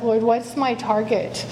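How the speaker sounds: ordinary talk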